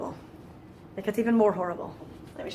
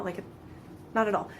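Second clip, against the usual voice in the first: normal talking voice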